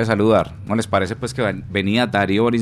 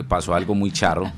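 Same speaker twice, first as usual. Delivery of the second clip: ordinary talk, nothing marked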